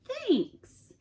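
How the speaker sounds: high, put-on bear voice, falling; a high, drawn-out thanks from the bear; Bear's thanks. Bear thanks guest again.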